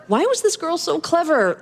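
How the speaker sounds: ordinary delivery, nothing marked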